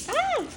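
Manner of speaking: high thrilled squeal; excited squeal. Real, not acted. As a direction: squeal with delight. Thrilled over lipsticks.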